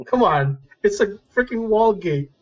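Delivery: normal talking voice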